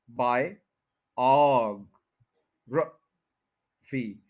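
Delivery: बाय, ऑग, र, फी with a pause after each piece; syllable by syllable. Shown as a demonstration. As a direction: hold ऑग long, keep र tiny. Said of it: "second पे stress है".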